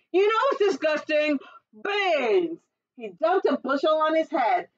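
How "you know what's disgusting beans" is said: high, indignant outburst; angry giant. A narrator's reading. Giant ranting about beans at the table, angry. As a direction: shout it out with disgust. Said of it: "he was still angry".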